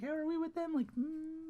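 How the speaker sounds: high, hushed, hesitant asking; unsure high squeak of a question; mock timid question.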